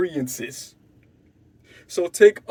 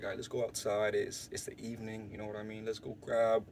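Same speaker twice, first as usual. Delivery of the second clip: hushed suggestion; 6 semitones lower, 9 dB quieter than usual. Retold or imitated. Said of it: "So I'm like".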